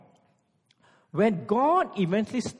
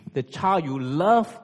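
ordinary talk

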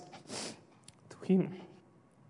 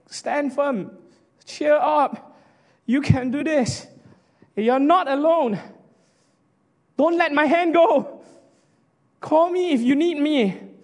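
higher, louder, slow and pleading comfort; reassuring encouragement; 9 semitones higher, 3 dB louder, slower than usual. Pretend. Pastor at the altar call reassuring a sister, high and slow. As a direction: urge her on like a pastor holding her hand. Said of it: "I want to tell you, sister".

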